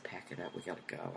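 low, very soft producer voice; hushed urgent order. Retold or imitated. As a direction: order it under your breath.

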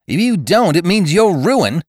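high, loud, sharp threat; an elder threatening the young rider sharply. A narrator's reading. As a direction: snap the warning at him hard. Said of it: "he said sharply".

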